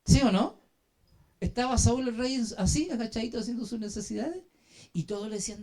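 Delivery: ordinary delivery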